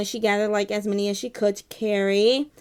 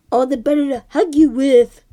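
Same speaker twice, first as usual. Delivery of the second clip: sly wolf; 3 semitones higher, 9 dB louder than usual. Acted. Wolf answering in story.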